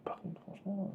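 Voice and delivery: soft high whisper. Real, not acted. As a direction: breathe it as a whispered aside. Aside to viewers.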